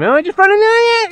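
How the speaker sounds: high, loud shout of discovery; real thrill of spotting him; excited shout. Real, not acted. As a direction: yell as he spots the rival. Spots rival in game.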